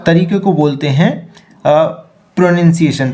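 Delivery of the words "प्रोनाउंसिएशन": ordinary delivery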